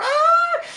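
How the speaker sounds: very high, loud scream; real anguished shriek. Real, not acted. Player missing by a hair, anguished shriek. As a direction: shriek as it slips away. Misses narrowly.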